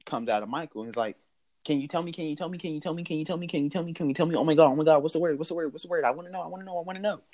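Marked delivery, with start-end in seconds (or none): 1.6-7.2 put-on high, rapid-fire begging like an eager little kid; impatient child pestering for an answer; excited five-year-old boy. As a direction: plead in a higher kid voice, repeating without a break. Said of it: "the inner five-year-old boy".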